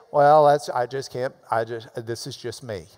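low, mumbling self-excuse, trailing off; mock excuse. Preacher mocking a listener's feeble excuse. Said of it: "Do not ever say".

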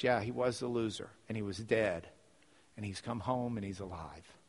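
low, soft, reassuring father; hushed, sincere reply in a father's role; gentle fatherly answer. Acted. Father gently answers older brother.

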